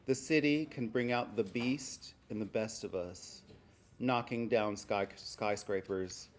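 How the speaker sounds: measured poem recital, pausing after each phrase; deliberate, projected spoken verse; poetry reading. Acted. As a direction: read your poem out to a room, firmly projected. Reads own poem aloud.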